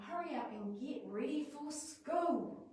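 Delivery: high, slow nagging mum; exasperated parent. Shown as a demonstration. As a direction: drag it out like a fed-up mum. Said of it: "I'm sure a few of us have said that".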